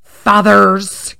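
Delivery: loud, slow, pointed jab at the fathers; mock lecture to the dads; sarcastic scold. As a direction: drag out the dig. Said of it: "I'm just like".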